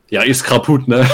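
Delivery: light mock Russian accent, laughing through it. Retelling the first-aid course, mimicking the Russian trainee with a light laughing accent. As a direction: imitate the Russian trainee's broken German. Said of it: "mit so einem russischen Akzent so".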